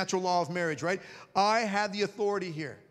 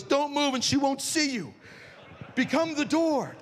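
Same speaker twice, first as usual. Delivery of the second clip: panicked inner thought; 9 semitones higher, slower than usual. Retold or imitated. Frozen in doorway, panicked thought.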